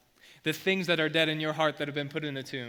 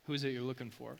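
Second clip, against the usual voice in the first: smirking; 3 semitones lower, 8 dB quieter, faster than usual. Acted. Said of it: "you can almost hear him, like, smirking".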